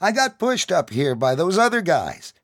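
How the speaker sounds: scared pleading. Acted. Kid pleading his case.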